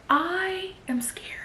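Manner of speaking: slow, drawn-out, hushed dread; scared whisper. Retold or imitated. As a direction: act out being scared, soft and stretched. Retold own fear while reading.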